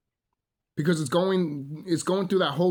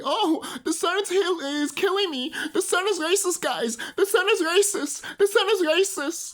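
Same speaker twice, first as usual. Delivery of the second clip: very high whiny mock complainer; making fun. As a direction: wail it in a squeaky, hammed-up victim voice. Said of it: "making fun of the fact that, uh, people will say".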